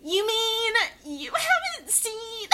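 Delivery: high, drawn-out mock snob; hammed-up film snob, loud and slow, near laughter. Demonstrating the private voice for a friend, film snob. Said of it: "this is the voice that I use".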